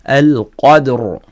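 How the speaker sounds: "القدر" ending on a still, heavy R; pausal stop. Shown as a demonstration. Teacher showing a stop on ra, pausal demonstration. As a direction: stop on the final R of "القدر" with no vowel after it. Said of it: "نقف على الراء هنا".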